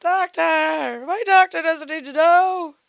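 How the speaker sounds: mimicking a high, whiny comic; Jerry Seinfeld. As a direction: stretch it out in a high mocking whine. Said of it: "sound like Jerry Seinfeld".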